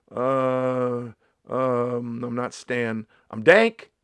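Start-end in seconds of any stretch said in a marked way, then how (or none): 3.3-3.9 boast. Pretend. Boasting slang.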